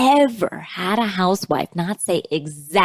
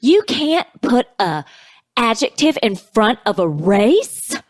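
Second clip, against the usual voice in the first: high, slow, emphatic imitation; mock-indignant scolding, climbing high at the end; retold objection; 5 semitones higher, slower than usual. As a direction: act out a slow, pitched-up protest. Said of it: "She goes".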